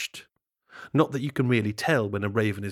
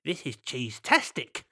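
cheeky vole. Acted. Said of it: "said a cheeky vole".

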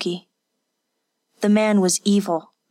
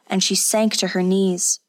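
ordinary delivery

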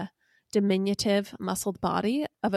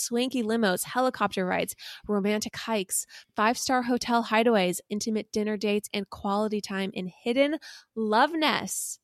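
ordinary talk

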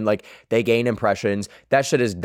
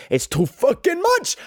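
fed up, pitch shooting up high; exasperated outburst. Real, not acted. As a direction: throw up your hands in high-pitched exasperation. Fed-up rant about K-pop groups.

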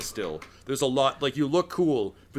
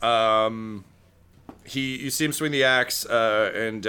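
ordinary talk, nothing marked

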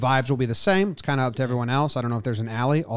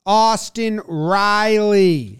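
announcer voice; 5 semitones higher, 8 dB louder, much slower than usual. Acted. Announcing player stat lines.

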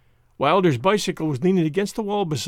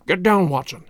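a quick, mildly raised warning; urgent cry; 3 dB louder than usual. A narrator's reading. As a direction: hiss it out to hide. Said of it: "cried Holmes".

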